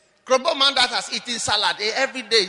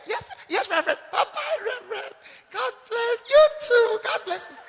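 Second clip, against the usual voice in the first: sweet-talking the reverend; 13 semitones higher, faster than usual. Retold or imitated. Woman on the phone to her reverend, high eager gushing. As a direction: gush at him like an adoring bride. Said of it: "At first, when he goes".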